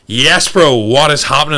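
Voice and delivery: loud, high, hyped-up hello to a client; big excited bro greeting. Retold or imitated. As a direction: burst in like a mate who can't wait to catch up. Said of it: "I'm like".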